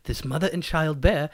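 solemn documentary narration sinking away; David Attenborough. Acted. Narrator showing starving polar bears, Attenborough impression. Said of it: "have you seen what David Attenborough".

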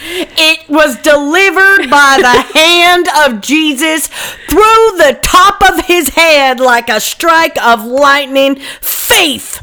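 high, slow, booming mock sermon; mock preacher. Joking about a preacher, a hammed-up high slow sermon. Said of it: "you should be a preacher".

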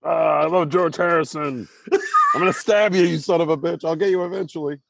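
high, slowed comic stalker voice; gush, then threaten in a silly high voice; hammed-up obsessed pursuer; crazed chasing fan. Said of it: "Sean's there chasing them".

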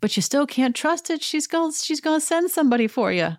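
high, mock-ominous warning to a friend. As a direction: squeak it out like a worried fan yelling at the TV. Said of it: "And I'm like".

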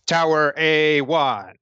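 slow, drawn-out robot announcer; robotic voice. Acted. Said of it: "the deep robotic voice and says".

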